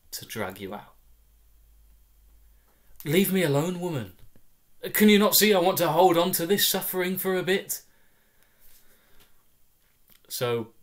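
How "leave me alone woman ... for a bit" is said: higher, louder, hammed-up wounded dignity, faster at the end; milk the suffering, shooing her away; mock tragic protest. Toddler self shoos off his rescuer, mock tragic protest.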